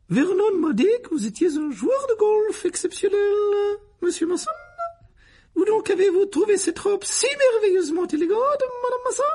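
very high, shrieky society gush; simpering Aunt Petunia. A narrator's reading. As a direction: flatter the guests in an extravagant squeal. (tante mondaine, flatteries suraiguës)

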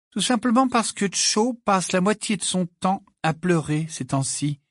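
high-pitched, distracted explanation; absent-minded. A narrator's reading. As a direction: explain it with attention elsewhere. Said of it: "d'un ton absent". (ton absent, voix aiguë)

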